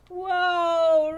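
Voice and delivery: usual speaking voice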